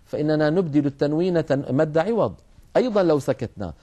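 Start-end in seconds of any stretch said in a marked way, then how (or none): none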